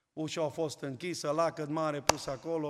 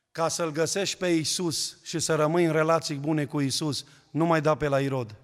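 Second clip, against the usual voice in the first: louder, insistent preacher's push; preaching warning; 6 dB louder than usual. Acted. Pastor warning the faithful, loud insistent preaching. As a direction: drive the warning home. Sermon warning about Herod.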